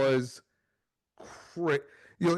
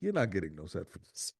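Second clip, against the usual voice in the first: deadpan refusal; 8 semitones lower, 5 dB quieter than usual. Retold or imitated. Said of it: "she goes".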